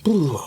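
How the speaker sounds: breathy playful shudder; mock shiver ooh. Real, not acted. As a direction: pretend to be spooked. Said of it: "chilling stuff".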